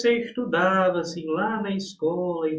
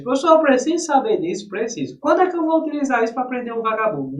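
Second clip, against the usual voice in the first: higher, louder whiny student protesting; 5 semitones higher, 5 dB louder, faster than usual. Acted. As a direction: play a pupil who doesn't see the point. Teacher voices a complaining student. (voz de aluno reclamando, mais aguda)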